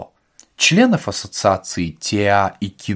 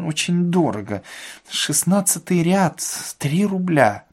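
hesitant theatre-goer; 6 semitones higher than usual. A narrator's reading. Воробьянинов balking at ticket prices, hesitant. Воробьянинов за кассой вслух рассуждает.

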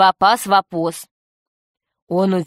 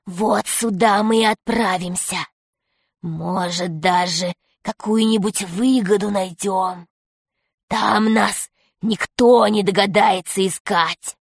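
hissing rat villain, plotting; Крыс. A narrator's reading. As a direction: hiss the plan like a scheming rat. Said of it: "прошипел крыс". (шипя, голосом крысы-заговорщика)

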